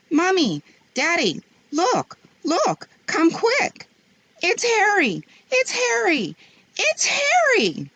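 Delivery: put-on high, loud, building children's cry; excited shouting. A narrator's reading. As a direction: call out to the parents in joy, rising. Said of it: "they began shouting".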